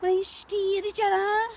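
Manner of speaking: slow, high put-on Scouse; comic Liverpool accent, stretching the words. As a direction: do a drawn-out, hammed-up Scouse accent. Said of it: "I'm from Liverpool".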